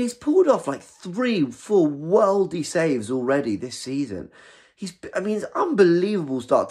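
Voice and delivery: slow, high, excited praise. Real, not acted. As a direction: gush about the save. Excited about a great save.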